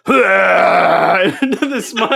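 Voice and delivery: loud, slow, cracking laugh through the words; helpless laughter. Real, not acted. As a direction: laugh so hard the words barely make it out. Reliving the vomiting story.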